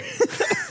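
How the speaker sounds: squeaky burst of laughter; high-pitched giggle. Real, not acted. High laugh after pun.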